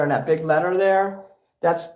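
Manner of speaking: ordinary talk, nothing marked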